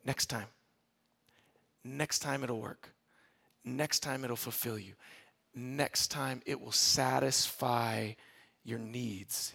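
low, hushed near-whisper with pauses; whispering temptation. Retold or imitated. Deceitful desire whispers in your ear, hushed and low. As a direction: murmur it softly and low, like a secret enticement. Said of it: "It whispers in your ear".

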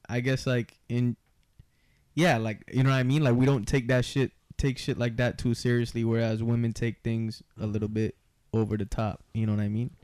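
ordinary delivery

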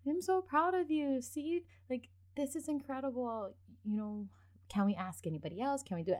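high, gushing praise; about to cry with pride; mother praising her son after his first sales; proud teary mom. Retold or imitated. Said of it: "I was like".

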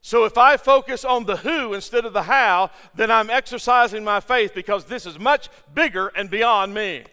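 loud, ringing preacher's build; full-force preaching. Real, not acted. Pastor driving home faith point to congregation, preaching loudly. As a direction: preach it out with rising fire.

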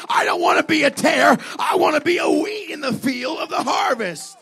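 high, shouted sermon at its peak; impassioned preacher in full cry; full-force preaching. Real, not acted. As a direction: pile up the phrases in a rousing revival shout.

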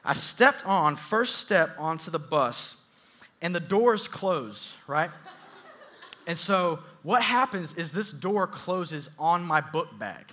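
ordinary delivery

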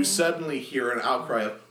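ordinary delivery

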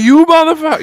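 loud mock Bronx curse; Bronx accent. Echoing cohost's Bronx Mario, hammed-up. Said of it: "Drew from the Bronx".

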